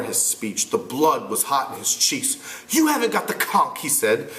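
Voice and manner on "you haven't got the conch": put-on higher, frustrated boy leader. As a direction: argue as an exasperated boy. Ralph voiced.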